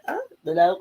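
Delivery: high squeal of a laugh breaking off her protest; laughing interruption. Real, not acted. Wife caught interrupting her husband again, laughing. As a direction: burst out laughing mid-protest. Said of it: "cut off interrupting herself".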